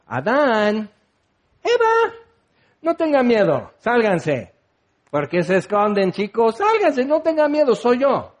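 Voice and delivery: high, pleading, soothing voice; gentle coaxing God. Pretend. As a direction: coax them out like frightened children.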